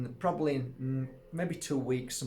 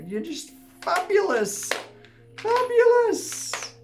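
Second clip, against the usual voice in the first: soaring high, louder and drawn out; a giddy, over-the-top compliment; gushing delight; 7 dB louder, slower than usual. Real, not acted. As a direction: swoon over the word fabulous. Gushing to viewers.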